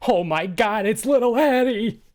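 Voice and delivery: high, loud, delighted shout; booming reunion cry, voice soaring; overjoyed greeting. Acted. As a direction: holler it as a joyful welcome. Tobert's joyful reunion greeting.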